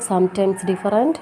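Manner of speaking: normal talking voice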